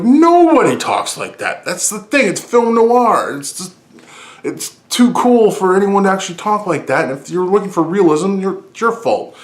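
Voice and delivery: high-pitched, heated comeback; exasperated rebuttal. Retold or imitated. Fan answering people who called it stupid, exasperated high rebuttal. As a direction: argue back in a high, worked-up voice. Retold argument with the film's detractors.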